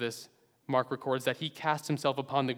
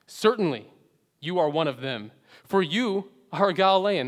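higher, louder, insistent charge; loud accusation; 4 semitones higher, 7 dB louder than usual. Acted. Bystanders confronting Peter, loud and insistent. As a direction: nail him with it, loud and high.

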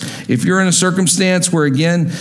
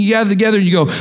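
ordinary delivery

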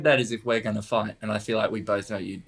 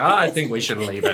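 imitating Trump; 8 dB louder than usual. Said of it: "And he was like".

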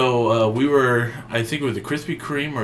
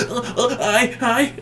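high, halting nervous hi; nervous stammering impression; 13 semitones higher than usual. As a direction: stammer it out like a shy guest. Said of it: "You were just going".

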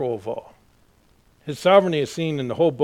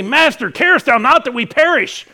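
panicked plea; 10 semitones higher, 11 dB louder than usual. Retold or imitated. Disciples cry out in storm.

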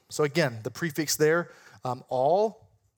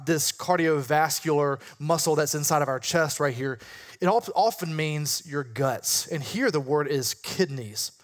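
usual speaking voice

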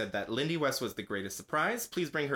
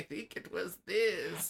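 put-on slow, higher-pitched old lady voice; 7 semitones higher, much slower than usual. Co-host asks for the old administration lady's voice again, old lady voice. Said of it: "Can you do her voice again?".

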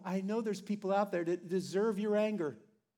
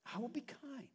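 much softer, almost breathed promise; quiet vow; 4 semitones higher, 14 dB quieter than usual. Shown as a demonstration. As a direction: say I will be kind nearly under the breath. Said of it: "submissive act to Christ".

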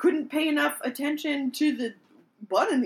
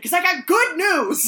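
loud, slow, higher-pitched sass; imitated sassy retort; 5 semitones higher, 8 dB louder than usual. Quoting his reply to why are you here, loud mock sass. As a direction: stretch it out with mock attitude. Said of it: "so sassy".

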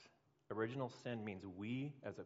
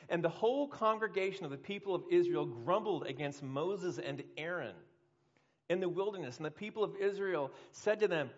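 scripture reading; 7 semitones higher, 9 dB louder than usual. Acted. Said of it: "let me read to you".

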